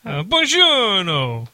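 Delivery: slow hammed-up Italian accent greeting; mock Italian accent. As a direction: stretch buongiorno in a cartoon Italian accent. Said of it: "Italian accent".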